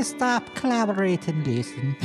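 ordinary delivery

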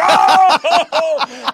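loud, high burst of surprise with a laugh; shocked oh. Real, not acted. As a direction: yelp as if a friend just got roasted. Reacting to Chappelle's burn.

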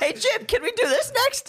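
put-on high, eager little kid begging; excited kid voice. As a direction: whine it like a kid at a carnival. Imitates Anthony as excited kid.

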